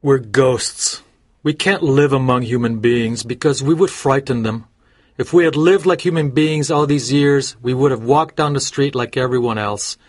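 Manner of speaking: resigned Clifford, level character voice; understated sorrow, evenly pitched; mildly sad. A narrator's reading. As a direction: lament a lost life without raising your voice. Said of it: "said Clifford sadly".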